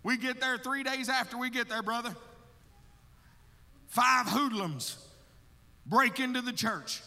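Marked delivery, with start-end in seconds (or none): none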